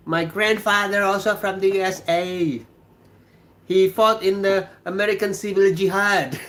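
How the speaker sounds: put-on Dictator accent. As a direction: ham up the Dictator movie accent. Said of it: "There was a movie called The Dictator".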